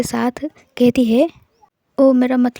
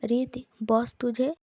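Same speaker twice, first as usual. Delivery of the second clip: said softly; 8 dB quieter than usual. Acted. Raghav tells Reet quietly about the boss. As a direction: lean in and keep it down, just for her. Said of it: "Raghav धीरे से कहता है".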